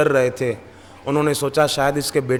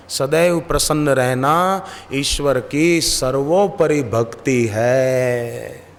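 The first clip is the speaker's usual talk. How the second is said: slow quoted motto; much slower than usual. Shown as a demonstration. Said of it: "हमारे गुरुदेव का संदेश है".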